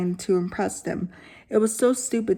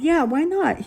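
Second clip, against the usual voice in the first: eager; 7 semitones higher than usual. Acted. David agreeing eagerly.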